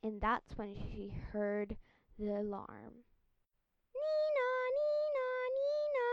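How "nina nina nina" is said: siren noise. Acted. Police siren imitated.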